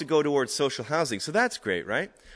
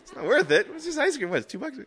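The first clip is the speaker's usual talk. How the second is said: laughing through the quote; 13 semitones higher, 5 dB louder, faster than usual. Retold or imitated. Quoting while laughing.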